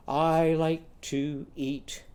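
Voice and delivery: normal talking voice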